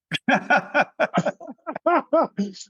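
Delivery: real laugh, pitched high; high burst of laughter. Real, not acted. Player laughing at his coach's punchline, high laugh.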